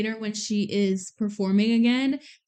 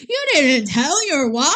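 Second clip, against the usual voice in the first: higher, louder incredulous question; shocked disbelief; 4 semitones higher, 6 dB louder than usual. Retold or imitated. Viewer reacting to the mom not being told, incredulous. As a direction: voice climbs in mock outrage. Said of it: "I was so shocked when".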